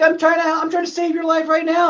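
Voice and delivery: high pleading mock voice; mock rescuer. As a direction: plead it like an exasperated lifeguard. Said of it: "And you're like".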